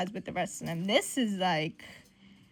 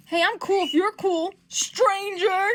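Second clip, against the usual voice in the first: higher, louder, punchy quote; emphatic peace offer; 9 semitones higher, 5 dB louder, slower than usual. Acted. Making up with an estranged teammate, emphatic. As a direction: play it up as a brash overture.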